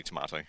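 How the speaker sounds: "tomato" with an AH in the middle. Shown as a demonstration. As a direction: say "tomato" as tuh-MAH-toh. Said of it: "I can say both".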